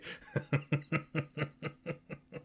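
a long, real fit of laughter. Real, not acted. Host cracking up over a cartoon scene, long real laughter. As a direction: laugh hard and let it roll.

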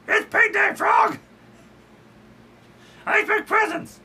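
very high, loud diva demands; high, loud, indignant puppet diva; Miss Piggy shouting. Acted. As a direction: shriek it like a spoiled pig star. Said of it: "Miss Piggy".

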